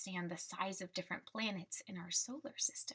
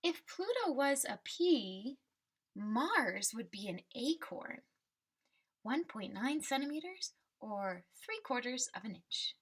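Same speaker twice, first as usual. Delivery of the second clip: put-on reading to children. Teacher reading the class a picture book, storybook voice. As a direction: read the refrain like a picture book for kids.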